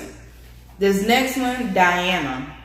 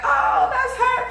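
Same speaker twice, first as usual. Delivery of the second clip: high, slow gasp of recognition; surprised recognition. Retold or imitated. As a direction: realize it's her, pitched way up. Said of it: "I was like".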